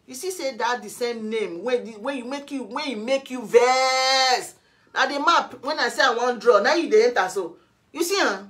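high, heated shouting; angry rant. Real, not acted. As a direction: lash out loudly at an accuser. Angry rant at absent accuser.

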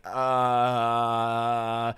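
long, low monotone "uh"; stumped hesitation. Retold or imitated. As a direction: drone while searching for an answer. Cashier stuck for an answer.

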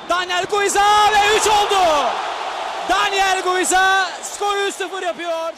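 high, loud goal call; screaming football commentator. Real, not acted. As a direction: belt out the score. Third goal called out. (bağıran spiker)

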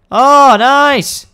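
high loud delighted cheer; light up at the find; excited. Real, not acted. Gamer delighted at finding loot.

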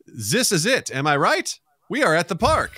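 AI's botched attempt at a German accent; failed German accent. AI showing a comedian its German accent, badly faked. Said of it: "use it as a soft Z".

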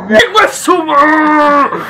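high, loud, drawn-out scream; screamed curse. Real, not acted. Player cursing after a scare, long high scream. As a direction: scream the curse slowly in shock.

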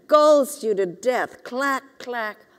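usual speaking voice

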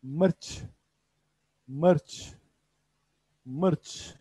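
Mırç said crisply with a rising tune; Urdu pronunciation. Shown as a demonstration. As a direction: model Mırç for the class to repeat. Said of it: "Biber derken mırç". (Mırç kelimesini yükselen tonla örnek ver)

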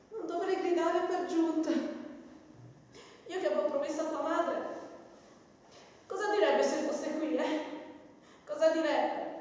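normal talking voice

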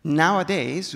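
ordinary delivery

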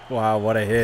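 normal talking voice

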